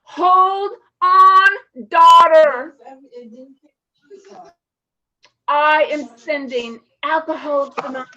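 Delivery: loud, booming call of God; God calling to his daughter through dark nights, booming. Retold or imitated. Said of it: "here's what God was saying to me".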